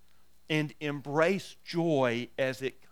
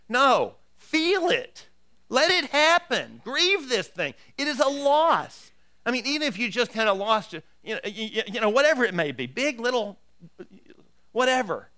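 louder, higher, driving sermon voice; full-force preaching; 6 semitones higher, 6 dB louder than usual. Acted. Pastor urging his congregation, in full-force preaching. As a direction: preach it to the back row with fire.

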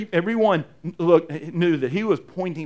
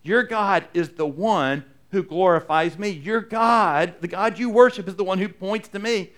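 rising, louder preaching build; full-force preaching; 4 semitones higher, 4 dB louder than usual. Acted. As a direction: drive it home from the pulpit. Preaching as Jesus' claim.

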